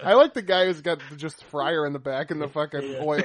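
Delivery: usual speaking voice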